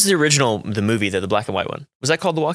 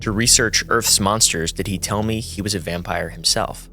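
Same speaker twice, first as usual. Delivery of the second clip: solemn narration over music; dramatic reading; 3 semitones lower than usual. Acted. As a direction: read it like a spooky essay. Reads a spooky essay aloud.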